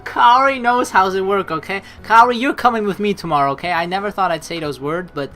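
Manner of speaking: loud insistence. Real, not acted. Loud teasing insistence to Kaori.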